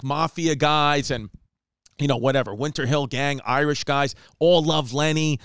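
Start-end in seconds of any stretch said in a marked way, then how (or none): none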